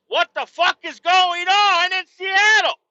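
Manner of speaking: high loud furious tirade; fired-up rant. Real, not acted. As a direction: erupt in outrage at the city. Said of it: "I'm getting all fucking fired up".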